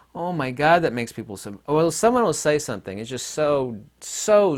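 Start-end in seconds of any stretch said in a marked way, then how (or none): none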